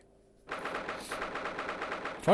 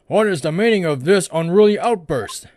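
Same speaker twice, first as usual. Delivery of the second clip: stern rebuke; 3 semitones higher, 7 dB louder than usual. Acted. Judge scolds outburst.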